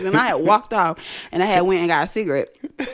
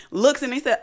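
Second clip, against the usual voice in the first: normal talking voice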